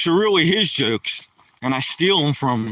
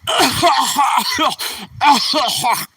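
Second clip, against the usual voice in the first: higher hacking cough-laugh; imitated smoker's cough laugh; 8 semitones higher, 3 dB louder than usual. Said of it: "It goes".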